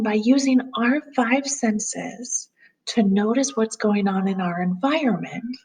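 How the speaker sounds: ordinary delivery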